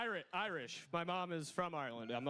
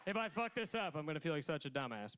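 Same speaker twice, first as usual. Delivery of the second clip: ordinary delivery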